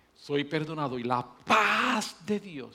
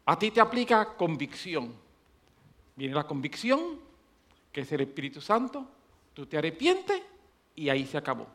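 normal talking voice

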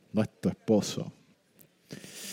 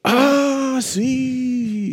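mild surprise; 13 semitones higher, 4 dB louder than usual. Acted. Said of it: "La mujer sorprendida le dijo".

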